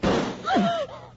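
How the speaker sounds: high, sudden gasp; sharp gasp. Acted. Man shot by Mary, sharp gasp. Woman shoots him.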